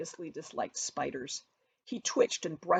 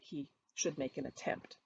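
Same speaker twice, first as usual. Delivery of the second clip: usual speaking voice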